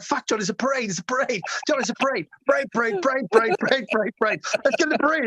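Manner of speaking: high, rising excited chant of parade; excited pleading. Retold or imitated. Urging John to join the parade, high excited chant. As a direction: pester him with growing excitement.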